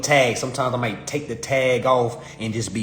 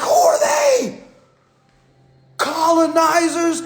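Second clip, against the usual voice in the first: high, loud, drawn-out mock outrage; mock outraged shout; 5 dB louder, slower than usual. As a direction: cry it out as an exaggerated accusation.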